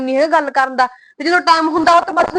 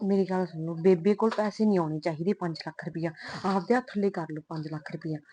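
much softer and lower, conspiratorial; hushed scheming; 6 semitones lower, 12 dB quieter than usual. Acted. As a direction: lean in and plot quietly. Wife schemes about money privately. (ਪਤੀ ਨਾਲ ਪੈਸਿਆਂ ਬਾਰੇ ਹੌਲੀ ਸਾਜ਼ਿਸ਼)

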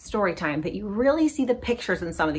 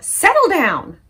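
louder, higher settle down; a firm mom's order; mom yelling; 9 semitones higher, 6 dB louder, slower than usual. Acted. Mom telling Reuben off, raised voice. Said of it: "Mom yelled".

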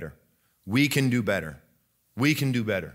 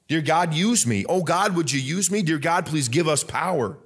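higher, earnest plea to God; preacher praying aloud; pleading prayer; 5 semitones higher than usual. Acted. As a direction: cry out to God to be used.

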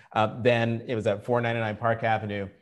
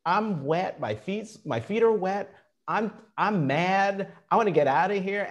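higher, exasperated retelling of her own thoughts; mock-frustrated outburst, pitched up; fed-up rant; 7 semitones higher than usual. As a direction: vent it like a soaked, fed-up job seeker. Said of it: "I was like".

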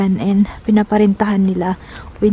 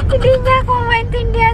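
high, quick, giggly retelling; amused; 11 semitones higher, much faster than usual. Real, not acted. Customer laughing at her failed sauce order, amused. Laughs over not being understood.

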